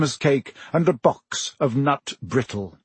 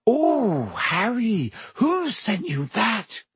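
high, slow, drawn-out wonder; high-voiced, astonished girl; awed amazement; 8 semitones higher, slower than usual. A narrator's reading. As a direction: gasp it out in awe, stretching the words. Girl stunned by friend's broom.

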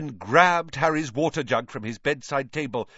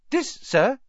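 high, squeaky elf voice; high-pitched humble little creature; meek house-elf. A narrator's reading. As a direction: squeak it meekly, matter-of-fact.

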